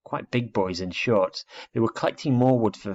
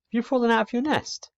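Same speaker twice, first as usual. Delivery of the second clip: put-on boy's voice. Boy asks owl kindly.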